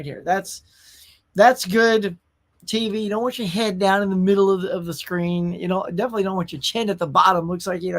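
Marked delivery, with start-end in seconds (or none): none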